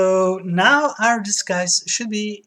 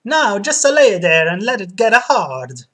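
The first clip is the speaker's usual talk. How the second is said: barber voice; 3 semitones higher, 8 dB louder, slower than usual. Acted. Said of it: "said the barber".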